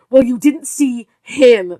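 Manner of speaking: slow, loud snap; wife snapping at her husband. Acted. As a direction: bite it out at him. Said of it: "Betty snapped".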